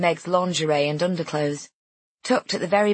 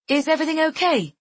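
high, raised check-in; calling through a door; 11 semitones higher, faster than usual. A narrator's reading. Friend checks on guest behind door, calling out. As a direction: call out the question, pitched high.

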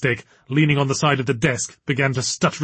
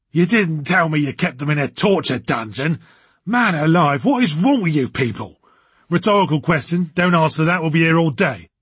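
raised, blustering put-on man's voice; irate, ranting boss; 3 semitones higher than usual. A narrator's reading. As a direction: rail at them, emphatic and higher.

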